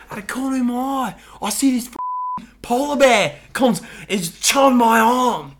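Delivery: put-on high, fast, loud Australian accent; hammed-up drunk Australian panic. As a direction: retell it as a rattled, drunk Australian snowboarder. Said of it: "your accent's actually pretty good".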